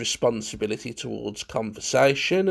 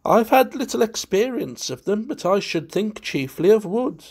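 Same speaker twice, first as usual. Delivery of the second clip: high, playful society wit; tittering guest; 8 semitones higher, 4 dB louder than usual. A narrator's reading. Valentine answers about the objects after tittering, amused high voice. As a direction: answer in a raised, amused, affected voice. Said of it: "Valentine tittered".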